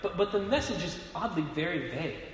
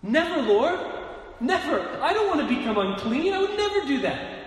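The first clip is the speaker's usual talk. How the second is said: loud, high, shocked protest; Peter, aghast, refusing the Lord's command out loud; horrified; 10 semitones higher, 8 dB louder than usual. Acted. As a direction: recoil in horror, pleading with the Lord. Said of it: "is horrified".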